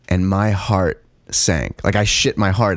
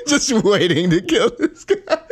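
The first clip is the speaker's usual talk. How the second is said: rising higher into laughter; laughing; 7 semitones higher than usual. Real, not acted. Retelling the bathroom standoff to a friend, laughing. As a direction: let the giggles climb as you tell it. Laughing while recalling knife wait.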